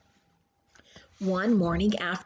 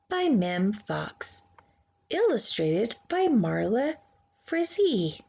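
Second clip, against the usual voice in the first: plain reading voice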